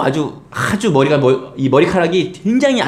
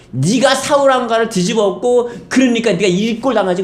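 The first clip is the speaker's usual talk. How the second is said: mocking taunt; 8 semitones higher than usual. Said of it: "조롱을 합니다".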